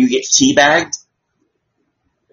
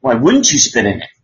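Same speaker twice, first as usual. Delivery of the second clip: usual speaking voice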